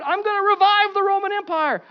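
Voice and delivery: high, loud pompous boast; mock boast. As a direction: ham up a dictator's grand promise.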